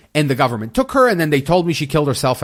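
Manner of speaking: ordinary talk, nothing marked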